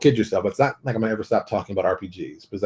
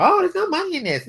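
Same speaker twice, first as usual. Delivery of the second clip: much higher, whiny mock voice; mocking whiner. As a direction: mimic a complainer with a sneer. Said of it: "you hear some people".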